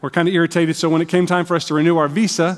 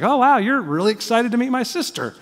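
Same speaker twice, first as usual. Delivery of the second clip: put-on higher, cheerful voice for the teenage boy, surprised; teen boy impression; 7 semitones higher than usual. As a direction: play the puzzled boy who thinks she is thrilled, brightly. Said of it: "So he's like".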